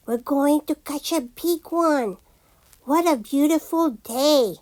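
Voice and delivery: usual speaking voice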